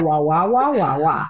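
mock dog barks for a foreign tongue; barking. Mother hears foreign talk as barking, mock woof.

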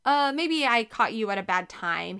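ordinary delivery, nothing marked

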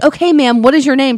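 polite employee. Retold or imitated. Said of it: "And they're like".

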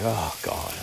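imitating a worn-out kid; softly sighed, mock-tired complaint; retold weariness. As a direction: let it out softly, like a tired child. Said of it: "she was, she looked tired".

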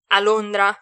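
Londra thrown back as a loud question; loud, doubting question; incredulous echo. A narrator's reading. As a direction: repeat Londra in disbelief. Echoes the answer in disbelief.

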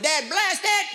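high burst to finish; exclaim. Acted. Climax of curse string.

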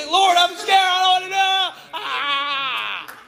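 high, loud panicked cry to the Lord; hammed-up terrified wail breaking into a scream; scared.

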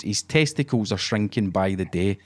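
ordinary talk, nothing marked

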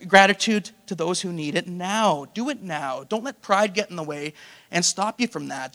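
normal talking voice

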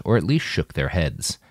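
ordinary delivery

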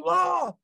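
high mock "aah" of flailing panic; high, held-back yell; imitated scream. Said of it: "And he's just like".

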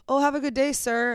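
high, cheery have a good day; breezy customer-service sign-off; lightly mocking cheery clerk. Strangers misgendering her, a lightly mocked cheery goodbye. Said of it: "they're like".

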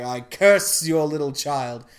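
slow, loud villainous pronouncement; Maleficent's curse. Retold or imitated. Fairy cursing a baby at her christening, villainous. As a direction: lay a slow, heavy curse on the child.

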